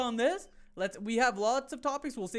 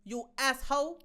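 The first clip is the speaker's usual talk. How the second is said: hint of a scolding boss; 8 semitones higher, 4 dB louder than usual. Mock boss scolding Josh.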